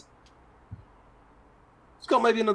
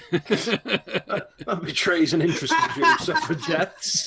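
higher pitch, words bubbling with laughs; amused, laughing; 5 semitones higher, 4 dB louder, slower than usual. Real, not acted. Game master teases player over a slip, laughing. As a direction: talk through real laughter.

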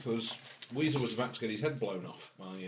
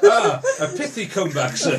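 laughing mock formal; 11 semitones higher, 11 dB louder than usual. Real, not acted.

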